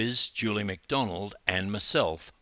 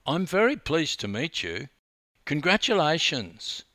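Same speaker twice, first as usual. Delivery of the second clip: put-on higher, friendly American accent; 7 semitones higher, 4 dB louder than usual. A narrator's reading. Young swimmer congratulating her rival, American accent. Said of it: "in an unmistakable American accent".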